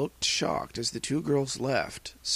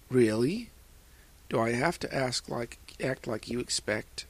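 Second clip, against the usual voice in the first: plain reading voice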